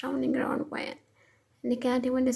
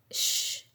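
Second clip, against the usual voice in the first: soft shush; 4 dB quieter than usual. Acted. Hushing her protest.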